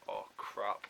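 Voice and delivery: soft, understated retold dread; dismayed aside. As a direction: say it under the voice, to yourself. Sinking realisation, said to himself.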